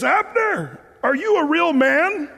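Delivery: high-pitched call across a ravine; acted yelling out. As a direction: call out tauntingly to someone far away. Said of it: "David yells out".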